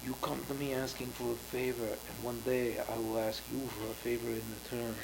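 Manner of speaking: low, quiet mob-boss threat; lightly voiced Godfather, hushed menace. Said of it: "she was like".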